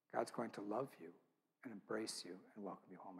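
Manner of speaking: softly comforting a dying friend on the phone; retold gentle reassurance. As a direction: soothe a frightened friend, hushed and gentle.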